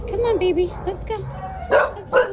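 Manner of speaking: high, eager coaxing to the dog. Real, not acted. Owner coaxing the nervous rescue dog to walk, high and eager.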